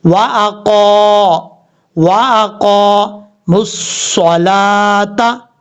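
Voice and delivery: very slow chanted recitation; Quranic recitation. Shown as a demonstration. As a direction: intone it slowly like tajweed. Recitation demo.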